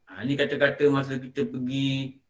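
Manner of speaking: ordinary talk, nothing marked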